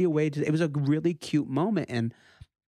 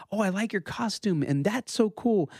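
put-on light, higher kid voice quoting his boy; lightly voiced excited child, higher; imitating his little son; 9 semitones higher than usual. Dad quoting five-year-old son.

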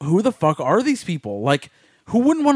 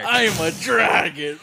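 slow, higher mock dragon voice; 3 semitones higher than usual. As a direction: ham up a dragon. Repeating the dragon bit.

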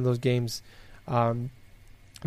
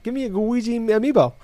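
excited; 8 semitones higher, 4 dB louder than usual. Retold or imitated. Excited fan wanting toy.

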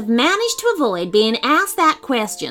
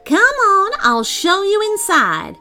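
much higher, louder eager call; eager fairy; 11 semitones higher, 4 dB louder than usual. Acted. Fairy inviting Devon inside, high and eager. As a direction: beckon him in keenly.

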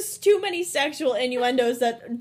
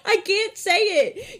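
helpless real laughter, higher pitched; laughing protest; 7 semitones higher than usual. Real, not acted. Host admitting she can't say the word, laughing helplessly. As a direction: squeal it out through the laughs.

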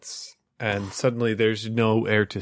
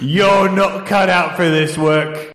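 louder, higher harsh father voice; stern disapproving father; 7 semitones higher, 4 dB louder than usual. Acted. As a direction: berate him like a cold father. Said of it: "Can you be my father who's telling me bad things?".

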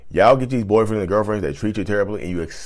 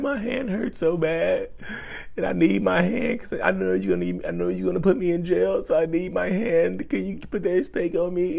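mock a crybaby abuser nursing his fist; whiny mock victim; 9 semitones higher, 4 dB quieter than usual. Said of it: "And they're like".

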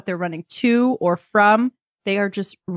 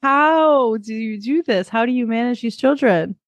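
exasperated disbelief; 5 semitones higher than usual. Retold or imitated. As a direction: ask it as if the task is impossible. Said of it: "And I'm like".

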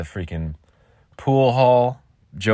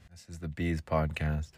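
much softer, lower aside; low throwaway; 4 semitones lower, 10 dB quieter than usual. Shown as a demonstration. Podcaster goofing with his ident, hushed low take. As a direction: drop it low and quiet.

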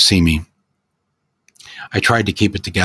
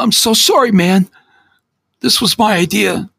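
high, begging, near tears; pleading for forgiveness, voice cracking high; tearful apology; 3 dB louder than usual. Acted. Teen begging his friend's forgiveness, high and tearful.

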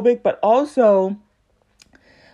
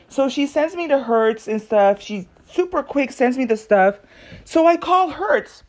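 usual speaking voice

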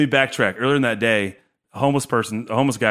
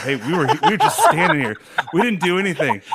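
mock innocence; 5 semitones higher, 3 dB louder than usual. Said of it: "We're like".

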